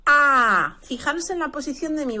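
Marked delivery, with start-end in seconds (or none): 0.0-0.8 loud wide-open Spanish "A"; the wrong sound, a loud open "A". Shown as a demonstration. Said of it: "la letra A nunca jamás se pronuncia".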